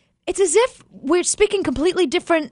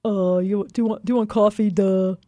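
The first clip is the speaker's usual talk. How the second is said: dumb Neanderthal guy; 5 semitones lower than usual. Retold or imitated. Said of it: "like a dumb Neanderthal guy".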